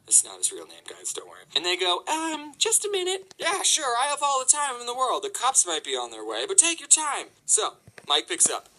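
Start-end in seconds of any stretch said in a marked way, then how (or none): 2.1-3.3 very high, slow, dopey employee; stupid voice. Retold or imitated. Store employee putting the caller on hold, stupid high voice. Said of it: "with their stupid voice". 3.4-7.3 high-pitched, exaggerated sarcastic agreement; mock sarcasm. Caller with the alarm going, sarcastic about waiting. Sarcastic reply to being put on hold.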